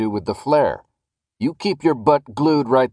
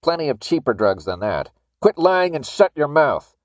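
contemptuous telling-off, building louder and higher; angry rebuke; 4 semitones higher, 3 dB louder than usual. A narrator's reading. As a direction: shut him down with scorn. Guard rebukes the pleading man.

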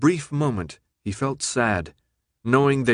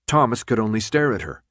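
usual speaking voice

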